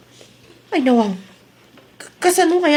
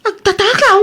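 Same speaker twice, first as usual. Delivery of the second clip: shocked echo; 7 semitones higher than usual. Acted. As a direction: throw the word back in shock. Accused of stealing, echoes it.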